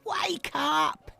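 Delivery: put-on high-pitched baby owl shout; urgent little wake-up call. A narrator's reading. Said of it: "shouted Baby Owl".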